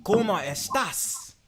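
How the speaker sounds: high, slow put-on Spanish accent; mock Spanish. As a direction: greet them in a hammed-up Spanish voice. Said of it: "I was like".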